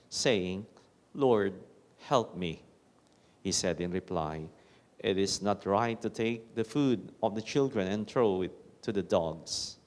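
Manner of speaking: usual speaking voice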